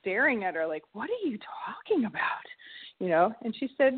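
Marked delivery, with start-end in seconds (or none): 0.9-2.4 whispered in disbelief; stunned whisper. Retold or imitated. Mother staring at her four-year-old, whispering in disbelief. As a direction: breathe it out, baffled.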